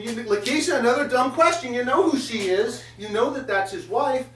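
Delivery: exasperated. Real, not acted. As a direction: scold her for the dumb question. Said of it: "And I'm thinking".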